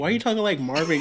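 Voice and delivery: ordinary talk, nothing marked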